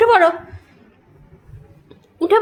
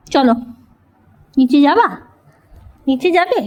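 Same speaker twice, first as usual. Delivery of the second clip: ordinary delivery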